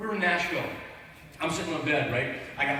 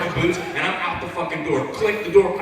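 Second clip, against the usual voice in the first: normal talking voice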